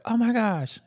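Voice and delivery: high, delighted "oh my gosh"; excited gasp. Retold or imitated. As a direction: gush like a long-lost friend. Excited barista greeting.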